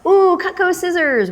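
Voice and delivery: high, laughing delight. Real, not acted. Host laughing over the Cutco scissors, delighted. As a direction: squeal it through a laugh.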